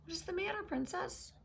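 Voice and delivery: high squeaky little frog. Acted. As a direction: pitch up for the curious frog. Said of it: "the frog asked again".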